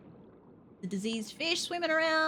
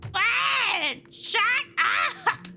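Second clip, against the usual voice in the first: laughing shout, higher; yelling; 9 semitones higher, much slower than usual. Real, not acted. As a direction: yell at him half laughing. Yelling at the moaning creature.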